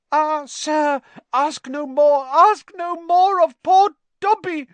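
very high, loud, pleading elf voice; squeaky house-elf begging to be spared; Dobby pleading. A narrator's reading. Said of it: "stammered the elf".

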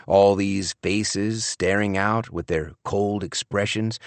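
usual speaking voice

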